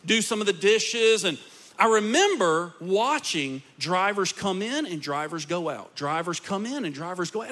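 ordinary talk

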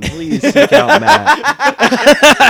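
very high, very slow mock plea; high drawn-out plea. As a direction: stretch it out high and loud. Mock plea to Fetterman's wife.

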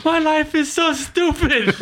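high, slow, defeated whine; exasperated whine. Retold or imitated. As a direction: moan it out in mock despair. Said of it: "She's like".

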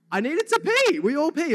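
high, loud outburst; blurted confession. Real, not acted. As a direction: blurt it out in a high, defensive shout.

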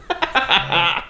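high burst of laughter; laughing. Real, not acted. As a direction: laugh out at his pain. Laughing at wasabi reaction.

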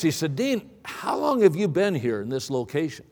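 ordinary talk, nothing marked

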